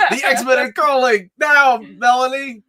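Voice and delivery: hammed-up German accent, cracking up; bad German accent. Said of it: "I can't do a German accent".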